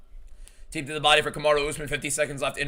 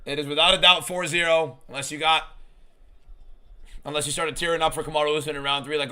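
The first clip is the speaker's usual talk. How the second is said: ordinary delivery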